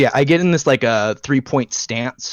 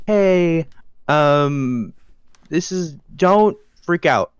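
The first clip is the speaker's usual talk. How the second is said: higher, drawn-out tentative approach; hesitant heads-up; 5 semitones higher, much slower than usual. Acted. Player's character turns to Sky before asking, hesitant heads-up. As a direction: ease into it gingerly, slowly and a little high. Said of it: "turn to Sky and be like".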